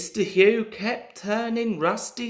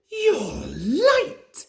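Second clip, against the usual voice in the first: higher, louder snap at a late engine; snapping; 12 semitones higher, 4 dB louder than usual. A narrator's reading. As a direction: bark out the complaint. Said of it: "he snapped".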